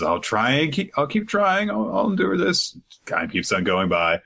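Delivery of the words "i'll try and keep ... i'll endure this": put-on higher earnest kid voice; child promises to endure, higher; child promising. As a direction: promise it bravely, pitched up. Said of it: "Luna says, promises".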